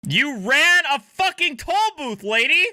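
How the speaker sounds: high, loud yell at the driver; furious high shout; shouting at the screen. Real, not acted. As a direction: yell it at her, outraged. Streamer yells at the driver.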